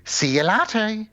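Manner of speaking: slow, high, drawn-out punchline; movie one-liner. Acted. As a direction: deliver it as a cheesy action catchphrase. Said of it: "I would love the, the line".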